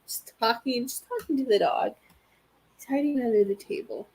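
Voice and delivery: usual speaking voice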